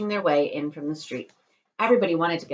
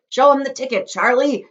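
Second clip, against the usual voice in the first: higher, loud joyful shout; 9 semitones higher, 11 dB louder than usual. Acted. Grandpa Joe shouts to Charlie to show the ticket, loud. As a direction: urge Charlie on. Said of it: "shouted Grandpa Joe".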